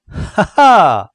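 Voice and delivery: high, loud mocking "ha ha"; sneering laugh. A narrator's reading. La crique mocking his baffled friends, sneering laugh. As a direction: snicker at the gang's slowness. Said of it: "ricana le compère".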